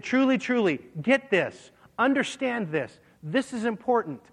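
high, pressing preacher emphasis on truly; urgent emphasis. Acted. Preacher voicing Jesus to his listeners, urgent and emphatic. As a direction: lean hard into each truly.

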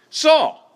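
SAUL shot out high and loud; emphatic answer. Real, not acted. Teacher to Bible class, loudly answering his own question. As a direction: land the answer hard, like a reveal.

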